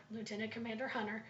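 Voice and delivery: usual speaking voice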